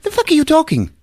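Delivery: mock woman's indignant squeal; high-pitched outrage. Woman answering a creepy man, high-pitched outrage. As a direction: pitch it way up in disbelief. Voicing shocked woman in retold scene.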